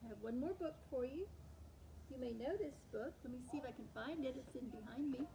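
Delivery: ordinary delivery, nothing marked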